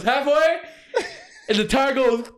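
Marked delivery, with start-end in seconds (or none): 0.0-0.6 high, laughing call of halfway; mock call-out. Coworker calling halfway to the tire crew, high and laughing. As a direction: sing out the warning carelessly. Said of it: "He goes".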